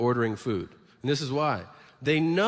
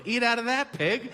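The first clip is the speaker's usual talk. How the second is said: much higher put-on voice, contemptuous; mock sneering worker; 9 semitones higher than usual. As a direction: sneer it down at the customer.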